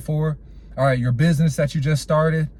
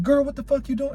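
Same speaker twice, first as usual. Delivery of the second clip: cursing at her; 9 semitones higher than usual. Retold or imitated. Boyfriend curses at his girl in the bar, mock angry high voice. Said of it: "was cursing at her".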